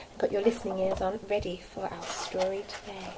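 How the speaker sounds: soft storytime whisper. Real, not acted. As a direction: lean in and whisper the question. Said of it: "asks children to listen".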